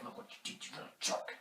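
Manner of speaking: very soft, harsh whisper; scolding whisper. Retold or imitated. Uncle Frank's head popping up to Kevin, scolding whisper. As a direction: whisper the reproach like a nasty memory.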